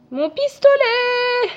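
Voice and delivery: very high, drawn-out wail; hammed-up high-pitched cry; mock anguished outcry. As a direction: wail it out, stretching the last vowel. Mock outcry over dropped gun.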